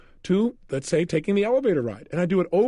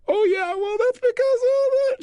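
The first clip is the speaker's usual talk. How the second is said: much higher, wailing imitation of a sobbing woman; hammed-up tearful squeak; mock crying; 4 dB louder than usual. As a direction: whine it through tears. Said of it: "She started crying again".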